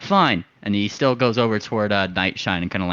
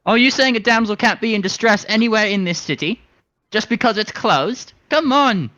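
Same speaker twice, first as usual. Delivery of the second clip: pitched way up, pleading and urgent; a flighty little rascal egging them on; high excited bird voice; 12 semitones higher, 3 dB louder than usual. Acted. Coaxing the drunk sailors toward the warehouse, high and eager.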